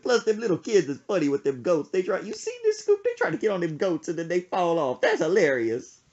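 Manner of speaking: lightly voiced mom. Son imitating mom laughing about kids on goats, lightly voiced. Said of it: "She said".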